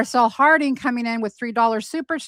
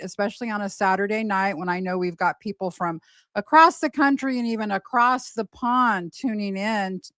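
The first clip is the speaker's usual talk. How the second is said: ordinary talk, nothing marked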